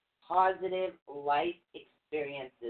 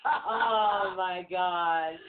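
laughing oh my God; 2 semitones higher, much slower than usual. Real, not acted. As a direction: say it while laughing. Still laughing.

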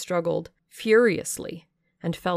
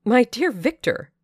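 higher, louder cry of alarm; urgent, raised voice of a worried friend; 7 semitones higher, 6 dB louder than usual. A narrator's reading. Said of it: "cried he".

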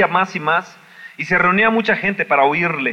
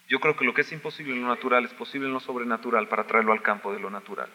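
soft preaching; 4 semitones lower, 5 dB quieter than usual. Acted. Drops to soft intimate preaching.

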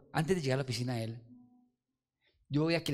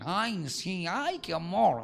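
lightly voiced sweet old pastor; a hint of the pastor's sugary "ay"; mild mock of a syrupy old pastor; soft-spoken pastor; 3 semitones higher, much slower than usual. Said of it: "hablaba el pastor así".

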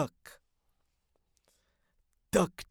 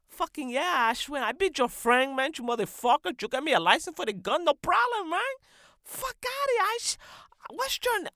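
much higher, loud hype; excited yelling; 11 semitones higher, 9 dB louder, faster than usual. Real, not acted. Host hyped about the free gun license, yelling. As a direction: shout it with glee.

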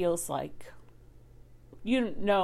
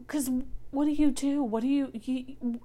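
desperate; 7 semitones higher than usual. Real, not acted. Desperate rhetorical question.

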